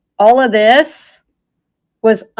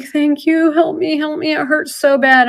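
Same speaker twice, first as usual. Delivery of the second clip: high pleading half-sob, lightly imitated; retold ugly crying; 7 semitones higher than usual. To the young man who came back, a high tearful plea. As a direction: beg the rescuer through pretend tears, pitched up. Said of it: "I start ugly crying".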